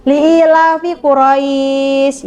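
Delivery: Li'ilafi Quraisy slow with the ai of Quraisy gently lengthened; softly drawn-out tajwid Quraisy; soft recitation. Shown as a demonstration. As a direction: glide through Quraisy smoothly. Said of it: "Dibaca lembut".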